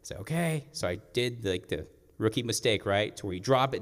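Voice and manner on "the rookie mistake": normal talking voice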